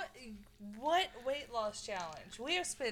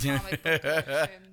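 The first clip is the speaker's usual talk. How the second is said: loud threat; 6 semitones lower, 12 dB louder than usual. Real, not acted. Threatening revenge on a rival.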